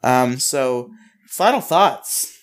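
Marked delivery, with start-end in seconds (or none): 1.3-2.4 higher, slower drawn-out announcement; segment announcer. Acted. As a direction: introduce the segment like a show host.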